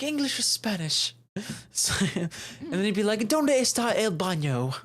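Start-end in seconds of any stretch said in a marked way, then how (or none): 0.0-1.1 higher, mock language question; imitating that guy. Mocking a guy who goes up to you, imitated. 3.2-4.7 slow, mock-bad Spanish accent; horrible Spanish accent. Mocking a would-be multilingual guy, horrible Spanish accent. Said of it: "most horrible accent of all time".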